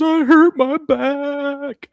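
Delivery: very high, drawn-out mock kid wail; screaming hurt child. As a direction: imitate a little boy wailing, loud and slow. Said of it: "I hear him screaming".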